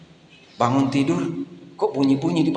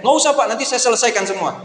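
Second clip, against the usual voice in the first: cheerful worker; 9 semitones higher than usual. Retold or imitated. Old worker tells the pastor it's exercise, high and eager. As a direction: play the keen neighbour brushing off thanks.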